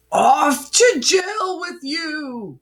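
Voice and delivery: high, slow, shouted screech; villain screeching at the skater. Acted. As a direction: screech the order at him. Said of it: "Cyrus screeched".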